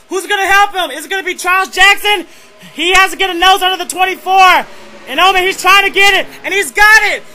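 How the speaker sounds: excited sim-race announcer on the final lap; live race play-by-play. Acted. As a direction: call the last laps live, building to a yell.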